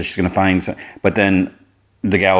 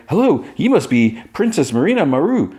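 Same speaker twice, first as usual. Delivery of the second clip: high smitten Jimmy; 9 semitones higher than usual. Retold or imitated. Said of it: "He's like".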